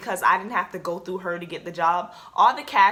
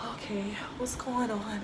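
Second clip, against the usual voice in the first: hushed, anxious mom imitation; bracing, breath-only question; scared whisper; 9 dB quieter than usual. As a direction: whisper it like a parent dreading the news. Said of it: "My mom was so scared".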